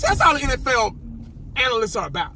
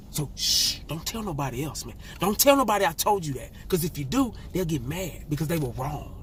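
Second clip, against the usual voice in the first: hushed secret; 7 semitones lower, 6 dB quieter than usual. Pretend. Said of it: "I'm whispering".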